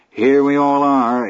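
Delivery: smug and slow; gloating, a grin you can hear; grinning. Acted.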